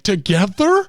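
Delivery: high, loud shocked question; shocked disbelief. Retold or imitated. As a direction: gasp it out incredulous. Hearing meth and morphine combined.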